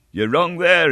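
sudden high, loud cry. A narrator's reading. Young hero bursting in on the stranger, loud cry. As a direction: burst in on them with a shout. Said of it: "he cried".

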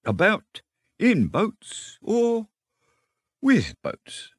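slow, musing, high-lilting pickup; resume the reverie unruffled, drawing words out; composed, carrying on. A narrator's reading. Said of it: "the rat went on composedly".

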